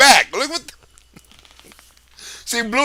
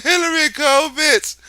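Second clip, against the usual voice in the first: mocking call; 6 semitones higher than usual. Mocking chant repeated.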